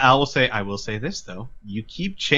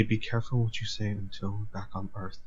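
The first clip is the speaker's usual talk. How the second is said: much softer, low aside to Tom; quiet warning; 6 semitones lower, 13 dB quieter than usual. Acted. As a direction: warn him under your breath. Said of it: "Al would quietly to Tom say".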